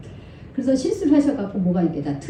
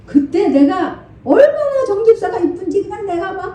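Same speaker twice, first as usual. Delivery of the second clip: normal talking voice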